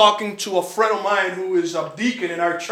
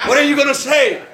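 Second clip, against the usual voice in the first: higher and louder, laughing as he asks; laughing plea; 6 semitones higher, 5 dB louder than usual. Retold or imitated. To his uncle, pleading again with laughter. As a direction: beg through laughter.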